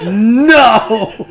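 big drawn-out retold no; imitated refusal. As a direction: exaggerate the shouted refusal. Repeating the acted refusal.

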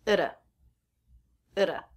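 "Ita" with a light flapped T; reduced at, flap T. Shown as a demonstration. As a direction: tap the T like a quick d. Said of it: "So the ending T will be a flap T".

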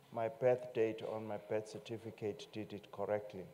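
ordinary talk

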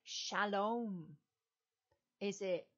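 ordinary talk

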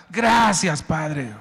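high, loud thanks to God; loud grateful cry. Acted. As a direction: shout your thanks anyway. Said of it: "No vendiste nada". (gritando gracias con fuerza)

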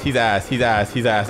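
normal talking voice

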